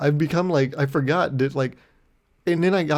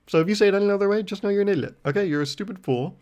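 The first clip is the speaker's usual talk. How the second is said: higher, playful mock insult; mock scolding; 7 semitones higher, faster than usual. Mock-scolding viewers who say gyro wrong, playful. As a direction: tease them like a smug know-it-all.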